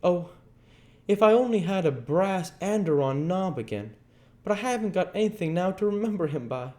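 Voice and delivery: put-on high girlish voice, sorrowful and fast; a young girl grieving to herself; Becky mourning alone. A narrator's reading. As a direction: sad little girl, voice high and wistful. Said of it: "She soliloquized".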